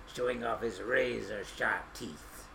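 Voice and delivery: usual speaking voice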